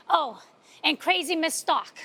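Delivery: ordinary talk, nothing marked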